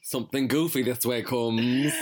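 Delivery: eerie movie trailer intoning; spooky announcer voice. Pretend. Repeats it as a spooky intro.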